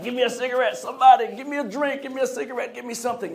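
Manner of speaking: high, urgent begging; stressed out. Acted. Acting out a stressed person wanting a cigarette or drink, frantic. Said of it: "You know how you stress out?".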